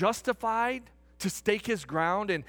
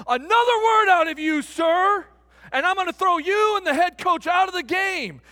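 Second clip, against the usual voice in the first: loud, raised-pitch warning; acted angry umpire; 9 semitones higher, 8 dB louder than usual. Umpire threatening to eject fan and coach, loud and angry. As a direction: bellow a stern ultimatum as the umpire.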